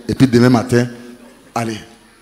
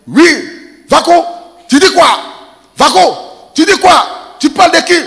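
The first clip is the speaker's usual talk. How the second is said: high shouted call across the road; yelling insult; 12 semitones higher, 4 dB louder than usual. Retold or imitated. Uncle calling across the road, high mock yell.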